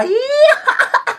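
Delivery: very high giddy squeal; shrieky tickled laughter. Acted. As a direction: squeal into giggles. Joking sweet talk, then squeal.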